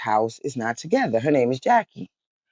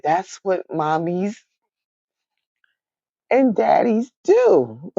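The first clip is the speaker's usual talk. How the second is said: slow matter-of-fact mom; 7 semitones higher, slower than usual. Retold or imitated. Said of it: "my mom then said".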